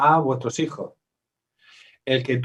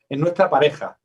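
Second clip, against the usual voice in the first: ordinary delivery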